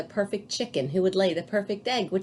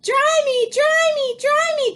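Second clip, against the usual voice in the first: put-on very high, squeaky baby demands; soggy chick whining; 5 dB louder than usual. A narrator's reading. Baby chick begs Jack to dry it, very high. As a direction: whine like a wet chick. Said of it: "cried the soggy baby chick".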